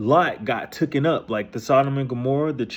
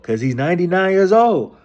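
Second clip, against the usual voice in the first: incredulous; 9 semitones higher, 7 dB louder than usual. Real, not acted. Incredulous aside about Abram.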